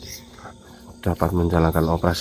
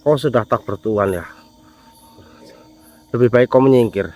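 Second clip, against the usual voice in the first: loud, higher scolding of the spirit; angry confrontation; 4 semitones higher, 6 dB louder than usual. Real, not acted. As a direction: drive it off for harming the villager. Scolding the spirit that hurt someone. (memarahi jin dengan suara keras)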